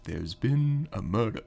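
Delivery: put-on slow southern accent, close to the mic; leaning-in southern drawl. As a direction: imitate an ASMR detective with a southern drawl. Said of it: "where he leans in".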